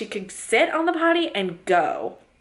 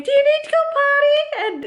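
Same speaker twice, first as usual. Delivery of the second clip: put-on high sing-song asking a toddler, much higher and louder; imitating a parent cooing at a small child; toddler-talk question; 4 dB louder than usual. Mom nagging her daughter, high cooing voice. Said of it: "stop saying".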